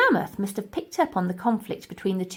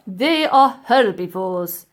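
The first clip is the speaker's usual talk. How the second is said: slow, pompous professor; a haughty old professor, slow and loud; 8 dB louder, slower than usual. Acted. Professor lecturing the ladies, slow pompous voice.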